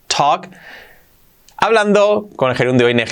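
normal talking voice

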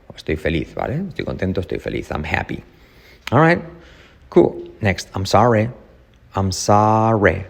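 ordinary talk

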